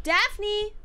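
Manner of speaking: high, loud hollering call; yelling a name. Pretend. Mock parent yells for a missing child. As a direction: holler for the kid like a frantic parent. Mock calling kids' names.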